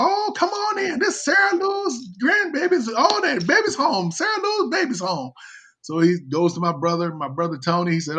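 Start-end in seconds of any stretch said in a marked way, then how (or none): 0.0-5.3 very high squealed welcome; mock falsetto cry of joyful greeting; imitating overjoyed relatives. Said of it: "they hugging him and kissing him".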